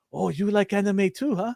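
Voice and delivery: a hint of the waiter's Japanese-accented English; light imitation of a Japanese accent. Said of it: "There's that accent again".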